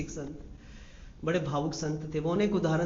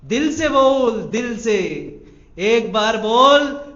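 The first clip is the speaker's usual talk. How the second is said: forceful preaching; 2 semitones higher, 7 dB louder, slower than usual. Real, not acted. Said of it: "preacher urges from heart".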